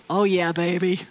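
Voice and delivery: a playful lover's aside; Adam's low mock swagger. Adam delighted at meeting woman.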